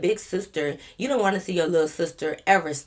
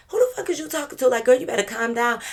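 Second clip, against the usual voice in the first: mock indignant yell; 6 semitones higher than usual. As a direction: fire back at the rude sister. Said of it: "my homegirl was like".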